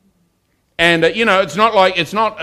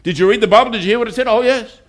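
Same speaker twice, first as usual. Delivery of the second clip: higher, lightly voiced smug churchgoer; mock churchgoer; 6 semitones higher than usual. Preacher mocking churchgoers who only listen, higher mock voice. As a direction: lightly send up the nodding listeners. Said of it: "There are people that hear".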